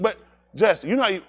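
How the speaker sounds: ordinary talk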